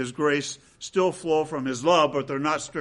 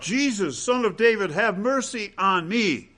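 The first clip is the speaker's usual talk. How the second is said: crying out; 6 semitones higher than usual. Retold or imitated. Blind man near Jericho calling to Jesus, raised pleading cry. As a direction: call out for help, pitched up and pleading. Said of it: "he cried out".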